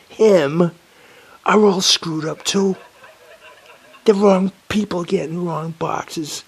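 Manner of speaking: high, loud, slow dismay; realising every box went wrong; exasperated. Real, not acted. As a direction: stretch it out in disbelief. Realises deliveries all wrong.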